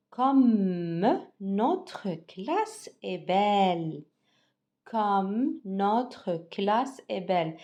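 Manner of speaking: Comme notre classe est belle read very slowly, each word clear, falling at belle; teacher models the sentence for pupils to repeat; good intonation. Shown as a demonstration. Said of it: "Avec la bonne intonation".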